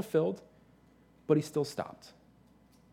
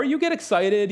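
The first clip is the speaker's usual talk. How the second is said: normal talking voice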